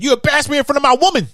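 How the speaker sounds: mock Bushrod. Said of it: "Bush Rod said".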